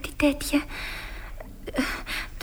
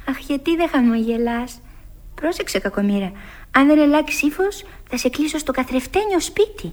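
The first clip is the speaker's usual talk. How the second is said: normal talking voice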